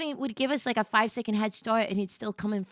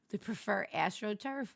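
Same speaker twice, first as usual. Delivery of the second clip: ordinary talk, nothing marked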